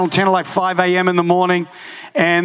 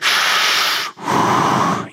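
long, exaggerated smoker's exhale; imitated cigarette drag. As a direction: mimic a deep drag on a cigarette. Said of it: "She took the deepest drags of her ciggy".

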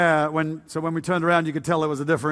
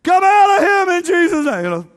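full-force high deliverance shout; yelling; 13 semitones higher, 8 dB louder than usual. Retold or imitated. Elders praying deliverance over a young hippie, yelling. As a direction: yell it as a command to a demon. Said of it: "then they started yelling".

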